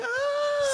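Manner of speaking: high excited squeal of joy; excited shriek. Retold or imitated. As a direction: shriek like a thrilled fan. Excited retold reaction.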